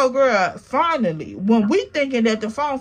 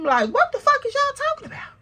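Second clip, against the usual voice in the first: high mocking voice; 13 semitones higher, faster than usual. Mocking friends' argument.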